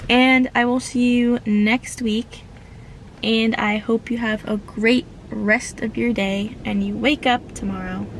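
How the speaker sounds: ordinary delivery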